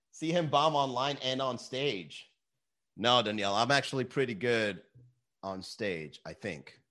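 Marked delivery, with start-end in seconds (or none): none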